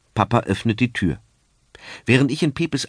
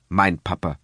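ordinary delivery, nothing marked

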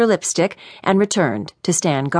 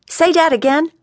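irritated, higher-pitched New Orleans voice; testy man demanding a repeat, saying "dat"; 10 semitones higher, 3 dB louder than usual. A narrator's reading. Irritated man with a limp snapping at his young visitor, irritated. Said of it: "Now he was irritated".

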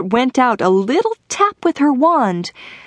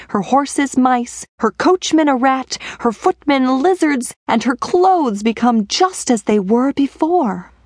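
plain reading voice

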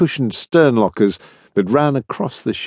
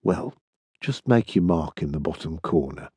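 low, soft undertone; murmured; 7 semitones lower, 8 dB quieter than usual. A narrator's reading. Clerk tactfully helps an uneasy Anderson sign, murmuring. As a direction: drop low and quiet, a discreet murmur. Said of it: "he murmured".

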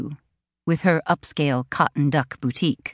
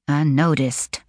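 ordinary delivery, nothing marked

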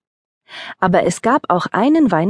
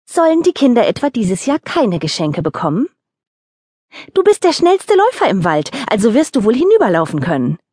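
scolding; 5 semitones higher than usual. Acted. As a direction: tell him off, no arguments. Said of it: "schimpfte sie".

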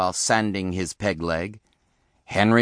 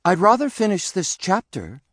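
high-pitched, polite little bunny; bookish bunny son; 9 semitones higher than usual. A narrator's reading. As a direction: decline the order in a high young voice. Bookish bunny son declines father's order.

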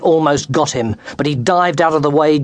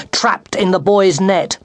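plain reading voice

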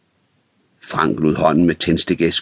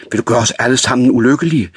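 fast, higher and louder pleading; frightened outburst; 7 semitones higher, 4 dB louder, faster than usual. A narrator's reading. Ulla pleading with Frank not to burn the mill, frightened. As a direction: rush it out in alarm. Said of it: "udbrød hun forskrækket".

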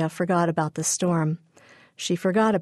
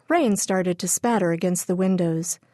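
ordinary delivery

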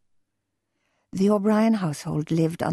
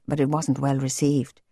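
usual speaking voice

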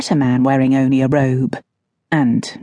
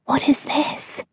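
unvoiced whisper; 9 semitones higher, 6 dB quieter, slower than usual. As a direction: hiss the question in secret. Sneaking into tense room.

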